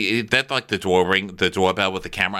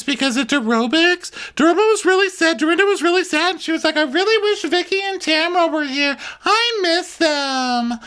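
much higher, louder breathless gushing; gushy Brandy; 3 dB louder than usual. Retold or imitated. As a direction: gush like a syrupy gossip.

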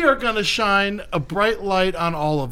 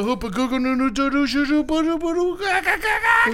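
nonsense syllables climbing high into bird squawks; 10 semitones higher than usual. Pretend. Host naming the game, rising bird-like babble. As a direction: babble the silly name, rising steadily to a squawk. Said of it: "like a little bird noise".

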